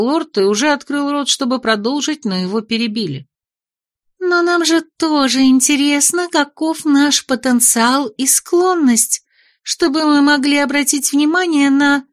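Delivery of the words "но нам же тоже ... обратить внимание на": put-on lightly raised girlish lady voice; a hint of a pushy young lady; high-voiced young lady. Malisa interrupts the lord.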